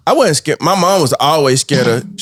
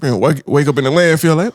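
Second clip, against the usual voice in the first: usual speaking voice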